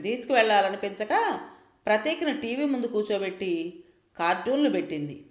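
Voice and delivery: ordinary delivery, nothing marked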